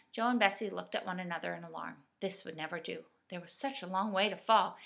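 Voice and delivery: ordinary delivery, nothing marked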